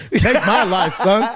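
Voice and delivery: loud, high, laughing dare; hammed-up defiance, cracking up; mock defiance through laughter. Joking defiance retold amid laughter.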